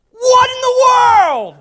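high, drawn-out comic shout; shocked yell. Acted. Jacob waking to find the wrong bride, shocked comic yell. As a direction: explode in loud, high disbelief.

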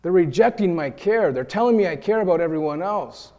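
self-justifying complaint, building heat; wounded indignation. Retold or imitated. Rejected helper stewing inside, rising indignation. Inner hurt monologue building.

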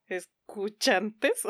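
slow, hesitant word search; drawn out while searching for the word; groping for a word. Real, not acted.

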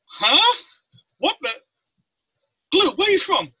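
high, loud double take; startled chief. Retold or imitated. Chief swings back to question the recruit, startled.

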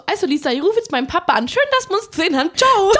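fast, rising to a loud Ciao; hasty tipsy goodbye. Retold or imitated. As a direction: rush off with a bright farewell.

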